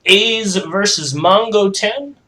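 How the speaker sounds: announcer voice. Acted. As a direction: stretch out the fighters' names like a ring announcer. Announcing the matchup.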